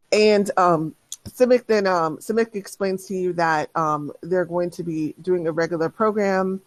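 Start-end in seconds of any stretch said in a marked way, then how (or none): none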